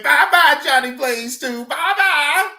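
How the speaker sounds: very high, loud singsong taunt; mocking high farewell. Streamer voicing YouTube waving him off, high squeaky taunt. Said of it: "They said".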